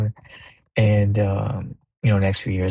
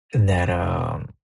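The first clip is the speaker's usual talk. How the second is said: normal talking voice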